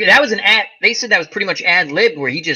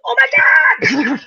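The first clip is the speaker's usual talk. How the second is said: mock amazed; 12 semitones higher, 3 dB louder, slower than usual. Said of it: "I was like".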